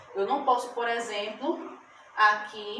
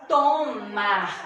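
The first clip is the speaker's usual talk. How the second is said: to-mar, two loud, held beats; split into syllables; 5 dB louder than usual. Shown as a demonstration. Teacher showing two syllables in tomar, sounded out loudly. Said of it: "Duas sílabas".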